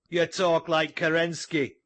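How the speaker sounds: a rough voice in the crowd shouting. A narrator's reading. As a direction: heckle the speaker from the crowd. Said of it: "shouted a rough voice".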